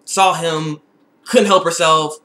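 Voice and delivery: normal talking voice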